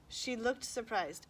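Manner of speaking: ordinary delivery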